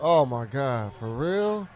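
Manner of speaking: loud, high disbelief; shocked outburst. Real, not acted. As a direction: blurt it out in shock. Frustrated at the game.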